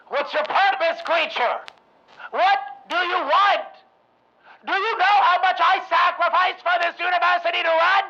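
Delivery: loud, high defiant shouting; furious shout. Acted. Rothman confronting the trapped creature, furious shout. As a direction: stand up to the monster in rage.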